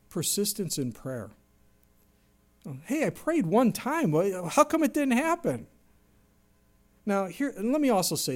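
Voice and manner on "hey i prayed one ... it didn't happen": higher, mock-whiny grumble; impatient complaint. Impatient believer complaining prayer went unanswered, whiny. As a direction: sound put out at God.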